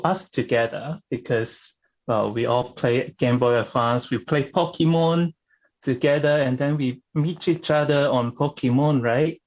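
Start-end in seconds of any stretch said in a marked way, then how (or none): none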